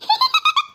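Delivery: very high, quick titter; tiny shrieky giggle; ghostly giggle. Acted. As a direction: impish spirit snicker.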